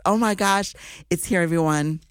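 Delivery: ordinary talk, nothing marked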